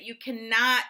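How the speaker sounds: normal talking voice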